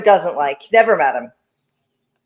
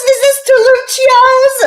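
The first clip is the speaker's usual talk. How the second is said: very high, loud, slow outraged question; suspicious Vito; 7 dB louder, slower than usual. Retold or imitated. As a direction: demand it like a furious Italian uncle. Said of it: "He would be like".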